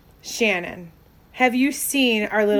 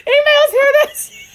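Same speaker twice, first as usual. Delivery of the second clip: very high, loud, squealed-out quote; laughing imitation; 9 dB louder than usual. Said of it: "She was like".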